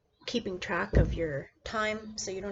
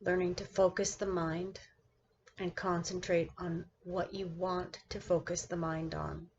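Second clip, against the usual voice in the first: measured meditation-guide delivery with long pauses between phrases; guided meditation. Acted. Teacher guiding her class through seated meditation, guided meditation voice. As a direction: lead the listener into stillness, one phrase at a time.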